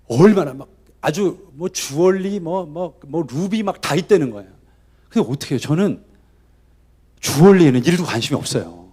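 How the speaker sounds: ordinary talk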